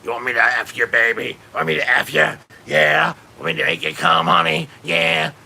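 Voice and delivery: a hint of a leering man; sleazy porn guy. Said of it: "a guy who talks like this".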